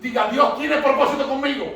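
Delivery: urging, repeated call to declare; preaching. Acted. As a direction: push the church to say it aloud. Call and response.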